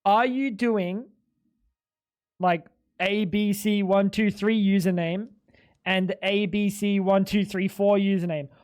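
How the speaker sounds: slow, spelled-out explaining to a dim clerk; each letter and number spaced out slowly; slow and simple. Retold or imitated. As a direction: lay it out word by word, patient but fed up. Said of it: "let me make this simple".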